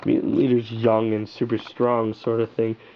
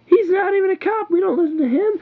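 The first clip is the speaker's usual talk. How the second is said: high, shrill mock rookie cop protesting; squeaky outraged rookie voice; indignant rookie; 5 dB louder than usual. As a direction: play the scoffing young cop, pitched way up. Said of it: "the other guy's like a rookie".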